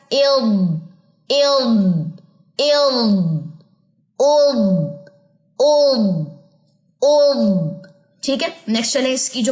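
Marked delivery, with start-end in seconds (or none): 0.1-3.2 "इल्म" said clearly with a falling close; articulated demo. Shown as a demonstration. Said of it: "next चलिए जेर के साथ". 4.2-7.7 "उल्म" said clearly with a falling close; articulated demo. Shown as a demonstration. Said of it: "practice syllables".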